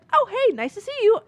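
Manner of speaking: high, loud, mock-cheery greeting; breezy movie mom. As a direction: parody a carefree film mom saying hi. Mimics glib movie birth mother.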